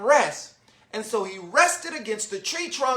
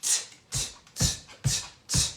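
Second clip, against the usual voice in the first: voiceless "tch" clicks, evenly repeated; monkey tutting; 6 dB quieter than usual. A narrator's reading. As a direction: imitate monkeys with sharp little tchs. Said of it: "back at him and said".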